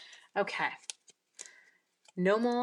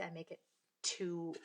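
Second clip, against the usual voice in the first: ordinary delivery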